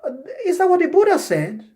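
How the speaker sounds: politely echo, then gently query, voice way up. Acted. Demonstrating a reply to erroneous ideas, high-pitched politeness. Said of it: "You politely say".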